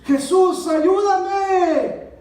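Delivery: high, loud cry for help; full-voiced plea, far above normal pitch; reenacted desperate shout. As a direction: shout it out as if being strangled in a dream. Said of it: "Le grito así de esa manera".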